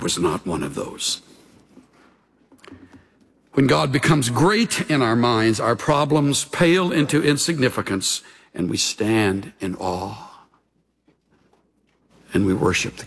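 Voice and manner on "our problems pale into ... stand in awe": ordinary talk, nothing marked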